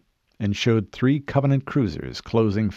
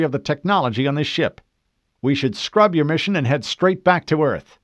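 higher-pitched officer making his case; insistent lieutenant; 6 semitones higher, 3 dB louder than usual. Acted. Lieutenant urging the Chief to scrub his mission, insistent officer voice. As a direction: argue formally with a commander. Lieutenant argues for returning home.

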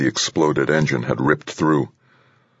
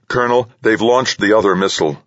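higher, urgent call to the colonel; 7 semitones higher, 3 dB louder than usual. A narrator's reading. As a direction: call out the warning, pitch raised. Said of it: "Hartunian called".

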